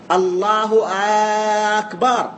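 أكبر begun with a long "aaa"; الله أكبر said as "allahu aaakbar"; lengthened hamza of akbar. Shown as a demonstration. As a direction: stretch the first vowel of أكبر after الله. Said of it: "مد همزة الله أكبر أو أكبر".

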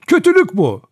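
high, loud outraged cry. A narrator's reading. Vera protesting the judge's accusations, crying out high. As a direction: call out in protest. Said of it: "diye haykırdı Vera".

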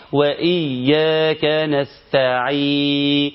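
slow chant, نستعين ending on an open ee with no n; last letter dropped. Shown as a demonstration. Teacher warning about the final letter, noon dropped. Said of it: "في حرف في الآخر ركز فيه".

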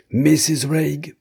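high, forceful call from the door; terrible shout. A narrator's reading. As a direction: call out her name in a terrible voice. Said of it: "cria de la porte une voix terrible". (voix terrible qui appelle)

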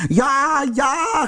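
very high, drawn-out exasperated yell; furious shriek. A narrator's reading. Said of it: "gilde de tovenaar woedend". (woedend gegild)

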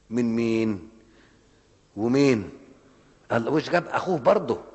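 preacher at full force; fiery preaching. Acted. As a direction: drive the point home to the crowd. Preaching builds to a climax.

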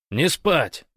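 loud, high command; commanding bark. A narrator's reading. Gosh to his drowsy friends, barking in a command voice. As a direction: bark it like an order. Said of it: "рявкнул Гош командным голосом".

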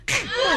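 high shouted yelp of pain. Acted. Drunk scratched by barbed wire yelps in pain.

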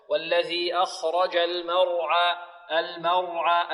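slow recitation of "المرعى" with full, heavy r; heavy ra, light mim. Shown as a demonstration. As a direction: chant it slowly, thin m and thick r in "المرعى". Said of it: "الميم مرققة والراء مفخمة".

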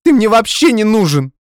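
high, loud tearful yell at him; furious shout. Acted. Girlfriend yelling at the man dumping her, furious shout. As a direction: scream it at the man leaving you. Heroine yells at boyfriend.

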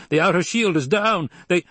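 high, loud alarm cut off; shout. A narrator's reading. Officer shouting to the Emperor as the ship rocks. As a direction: yell it before being drowned out. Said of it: "officers shouted".

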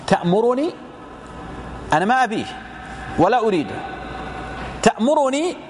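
acting out a reluctant woman's query, pitch lifting on each question; questioning protest. Freed woman asks the Prophet whether he orders her back, questioning protest.

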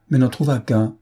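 plain reading voice

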